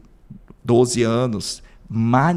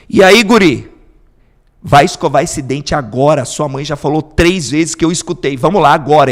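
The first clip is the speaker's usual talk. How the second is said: louder, firm fatherly order; stern father; 3 semitones higher, 9 dB louder than usual. Retold or imitated. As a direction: tell the kid off like dad. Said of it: "Ele vai chegar e fala bem assim". (pai firme dando ordem ao filho, mais alto)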